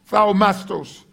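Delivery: ordinary talk, nothing marked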